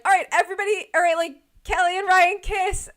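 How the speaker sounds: high, loud call to the group; a photographer herding the wedding party. Retold or imitated.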